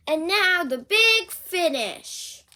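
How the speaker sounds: loud, slow, showy build-up; grand announcement. Acted. Piggy announces her big finish to Gerald, grandly. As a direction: announce it like a showman.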